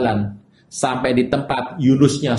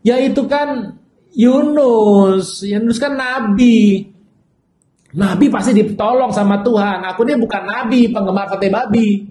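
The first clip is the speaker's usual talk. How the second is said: higher, hesitant voice of a wavering believer; doubting churchgoer; 5 semitones higher than usual. Retold or imitated. Churchgoer explaining doubts to the pastor, higher and hesitant. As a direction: imitate a churchgoer hedging with the pastor.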